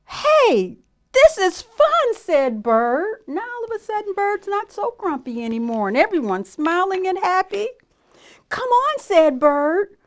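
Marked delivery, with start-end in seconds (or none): 0.0-2.1 high, loud, drawn-out cheer; delighted Bird. A narrator's reading. Bird finds jumping with friends fun, high delighted exclamation. 8.5-9.0 high, eager call; cheerful invitation. A narrator's reading. Bird invites the animals along, high cheerful call.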